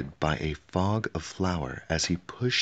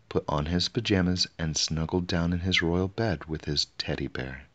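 plain reading voice

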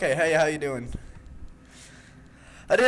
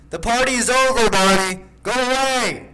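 loud, higher shouting at Bonnie; yelling; 6 semitones higher, 6 dB louder than usual. Real, not acted. Gamer yelling at a creepy animatronic, loud shout. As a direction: shoo him off with a yell.

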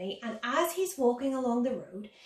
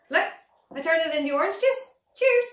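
excited; 6 semitones higher, 6 dB louder than usual. Acted. Magic trick reveal.

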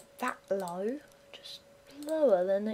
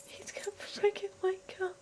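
scared whimper; 9 semitones higher, 4 dB quieter than usual. Real, not acted. Fear the zombie wakes.

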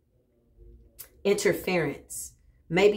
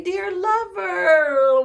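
gushing; 11 semitones higher, 8 dB louder, much slower than usual. Real, not acted. Drawn out endearment.

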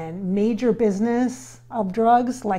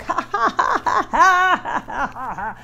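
staccato ha-ha laugh, higher; imitating his laugh; 5 semitones higher, slower than usual. Showing viewers the spirit's laugh, imitated ha-ha laugh. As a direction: mimic his distinctive hearty laugh. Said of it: "he has this laugh like".

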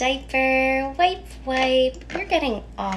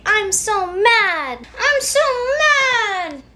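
rising to a high, loud cry; modeled mad; 7 semitones higher, 5 dB louder, slower than usual. Acted. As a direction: build into a frustrated wail. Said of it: "You can say".

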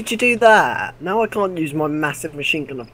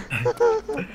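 laughing, voice cracking slowly; 6 dB quieter than usual. Real, not acted. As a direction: let the voice crack mid-laugh. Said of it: "had a bit of a voice crack there".

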